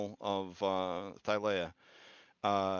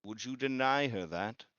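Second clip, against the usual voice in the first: lightly raised prophetic voice; oracle voice; 5 semitones higher than usual. Acted. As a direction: speak as a grave seer. GM voicing Kabira Nasir.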